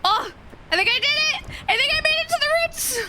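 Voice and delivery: rising thrilled voice; excited. Real, not acted. Excited success in game.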